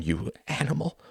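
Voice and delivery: high, soft, breathless insult; panted. A narrator's reading. Wife clutching a cushion insults husband, panting. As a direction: gasp the insult at him. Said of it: "she panted".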